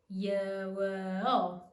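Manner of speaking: "Yuo" stretched out, ye-w-o; slowed-down triphthong. Shown as a demonstration. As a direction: draw "Yuo" out slowly through Y, W and O. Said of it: "using the technique that I've just shown you".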